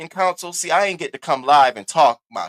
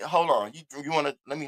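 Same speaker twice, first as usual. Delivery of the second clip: ordinary talk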